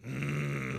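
creepy throaty "mm"; creepy grunt of relish; Carl. Retold or imitated. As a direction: hum it like a leering oddball. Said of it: "Reminds me of Carl from, uh, Sling Blade".

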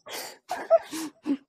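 very high, squealing fake sob; mock sobbing. Pizza worker breaking down mid-story, hammed-up sobbing. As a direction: burst into put-on sobs mid-story. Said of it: "some tears out of my eyes".

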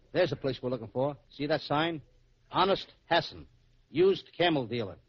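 normal talking voice

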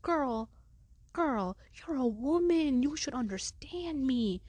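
high, slow, drawn-out mock plea; pleading wail. Student begging female coach to understand, wailing. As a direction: wail it in exaggerated appeal.

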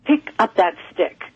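a hint of a commanding male voice; understated imitation of a stern disembodied voice; deep masculine voice. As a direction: lightly voice the mysterious commanding voice. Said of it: "this deep masculine voice say to me".

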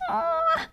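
soft, very high "aw"; high cooing aw. Real, not acted. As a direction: coo it like at a puppy. Cooing at sweet moment.

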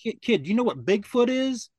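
high-pitched, impatient mock questioning; retold upset homeowner. Upset homeowner quizzing the young deputy, exasperated. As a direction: imitate an exasperated man quizzing a kid. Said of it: "he was really upset by it".

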